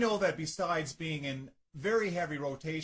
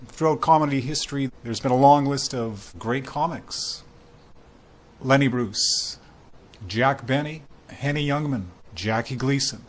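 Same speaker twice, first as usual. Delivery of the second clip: booming louder radio-announcer voice introducing the show; radio host intro; 3 semitones lower, 10 dB louder than usual. Acted. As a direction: welcome listeners like a big-time radio host. Radio host opens comedy show.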